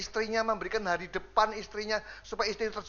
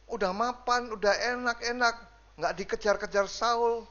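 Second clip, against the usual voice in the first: ordinary delivery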